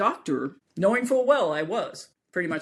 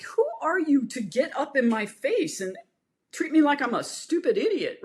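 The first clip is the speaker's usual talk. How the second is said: indignant, higher-pitched inner rant; retold anger; 7 semitones higher than usual. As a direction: act out being mad, voice raised in pitch. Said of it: "'cause I'm mad".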